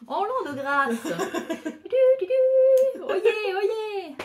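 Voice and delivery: high loud slow proclaiming; town crier. Pretend. Proclaiming old style.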